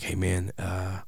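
softly, nervous asking; hushed and tentative question; quiet, timid. Retold or imitated. Timid ask to star.

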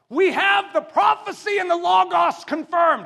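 high, loud shout from the pulpit; preaching shout. Acted. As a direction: declare it with full force. Shouted proclamation.

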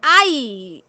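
a sudden loud cry of dismay; exclaimed. A narrator's reading. The little fountain cries out at the news, a loud exclamation. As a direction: cry out "¡Ay!" sharply. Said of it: "exclamó la fuentecita".

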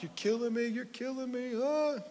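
put-on high, strained childish cry of pain; preacher imitating his child self wailing under the tweezers; mock kid's wail. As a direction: ham up a kid wailing in pain. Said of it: "I was screaming".

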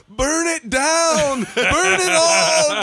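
shouted high and slow, burn it down; hammed-up furious shout, drawn out; mock rage. Friend mocking Steve's angry posts, shouted mock rage. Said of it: "Steve is all".

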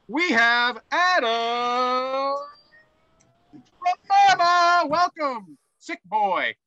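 high, slow, drawn-out announcer call; ringmaster. Pretend. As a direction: boom out the guest like a circus host. Introducing Adam like an announcer.

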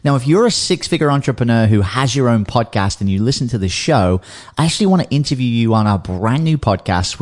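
loud, upbeat promo read. Acted. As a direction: pitch the offer like a radio spot. Ad read before the episode.

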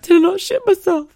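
high, loud pleading; hopeful disbelief. Retold or imitated. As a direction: dare to hope. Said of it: "I went".

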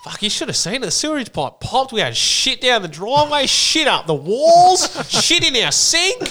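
mock shocked Sheila. Retelling the neighbour's shocked account.

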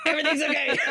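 badly faked calm over hidden fear; mock bravado. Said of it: "I will not show any fear".

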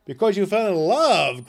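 loud, high, scornful growl. A narrator's reading. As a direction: sneer it back in disbelief. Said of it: "growled Scrooge".

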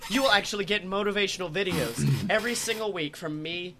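hammed-up announcer plug; ad read. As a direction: sell it like a pitchman. Sponsor ad read.

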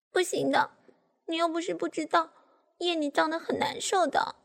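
high, wheedling protest; petulant whine. A narrator's reading. Daughter stamps and protests to her father, petulantly. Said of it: "我跺着脚".

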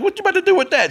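shocked, voice jumping up high; alarmed. Retold or imitated. Asking the old lady about the tongs, shocked. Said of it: "I'm like".

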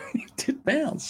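high, soft squeak; squeaky high repeat. Acted.

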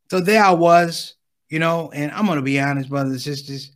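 ordinary talk, nothing marked

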